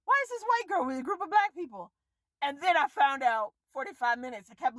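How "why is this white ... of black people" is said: incredulous inner voice. Retold or imitated. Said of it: "and I was like".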